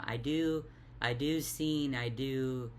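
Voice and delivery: ordinary delivery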